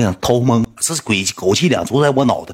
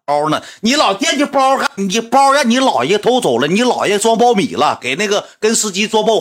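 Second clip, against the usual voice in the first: exasperated rant; 11 semitones higher than usual. Retold or imitated. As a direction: vent it like you're fed up with her. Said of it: "人生气急眼的话".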